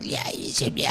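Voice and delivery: voiceless demon rasp in an ancient tongue; ancient demon whisper. Retold or imitated. Demon whispering to the terrified husband, unvoiced. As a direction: breathe it like an old demon. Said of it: "I'm speaking a language of that, it's so old".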